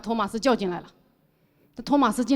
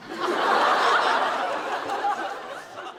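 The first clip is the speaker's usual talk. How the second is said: breathless laugh; 8 semitones higher than usual. Real, not acted. 笑出声.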